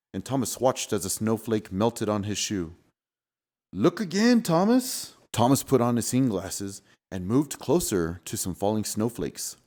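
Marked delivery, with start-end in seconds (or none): 3.7-5.1 playful prompt. Acted. Knowing prompt.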